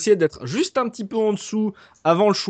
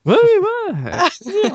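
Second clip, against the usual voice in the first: squeaky excited yeah; 14 semitones higher, 4 dB louder, much slower than usual. Acted. Excited joking agreement with teammate.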